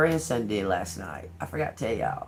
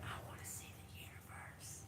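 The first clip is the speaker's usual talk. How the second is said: put-on hushed, awed child's whisper; whispered wish of a small grandson; grandson's whisper; 28 dB quieter than usual. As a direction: breathe it like a little boy in wonder. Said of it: "That's how he said it, just like that".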